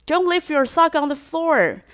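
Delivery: nagging again, pressing on "sock". Shown as a demonstration. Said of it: "第二次也还在滑手机".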